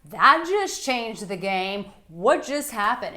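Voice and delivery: hammed-up surprise; mock shock. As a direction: act out a jolted woman. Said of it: "it's like".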